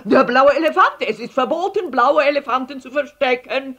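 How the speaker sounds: high, loud, breathless outrage; indignant accusation. A narrator's reading. Herr Letscher accusing Paul's family, indignant. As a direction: declare it forbidden at full voice.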